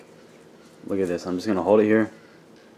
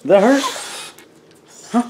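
pet talk; 11 semitones higher, 8 dB louder than usual. Real, not acted. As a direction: coo at the dog, much higher. Talking to the dog.

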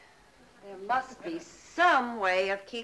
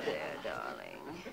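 soothing; 11 dB quieter, slower than usual. Real, not acted. Soothing a frightened pet cat.

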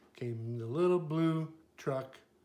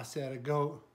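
ordinary delivery, nothing marked

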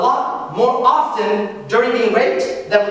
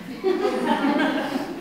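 laugh; 6 semitones higher, 6 dB quieter than usual. Real, not acted. Laughs after quote.

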